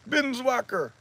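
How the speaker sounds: high-pitched garbled imitation; mock garbled word in a high voice; imitated stroke speech. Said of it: "He can't speak".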